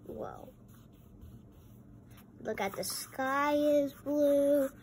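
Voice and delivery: ordinary delivery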